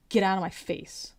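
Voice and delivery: normal talking voice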